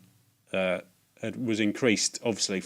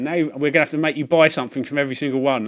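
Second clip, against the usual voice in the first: higher, louder mock-stern shop lady; teasing charity shop lady, higher and louder; hammed-up shop lady; 5 semitones higher, 8 dB louder than usual. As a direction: play the joking shopkeeper, loud and high. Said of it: "probably hamming it up".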